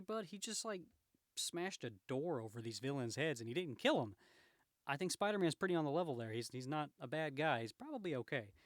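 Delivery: ordinary delivery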